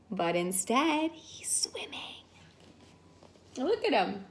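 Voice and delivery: soft, voiceless hush; secretive storyteller whisper. Real, not acted. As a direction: lean in and whisper the surprise. Narrator whispered aside.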